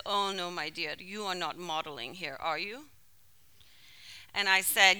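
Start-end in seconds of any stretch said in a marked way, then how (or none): 0.1-2.9 put-on gentle old man.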